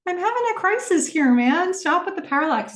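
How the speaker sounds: high, exasperated panicky voice; exasperated Cam. Retold or imitated. Cam snapping at Tom about parallax, in a high exasperated voice. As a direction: play her cracking up mid-crisis. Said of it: "Cam's like".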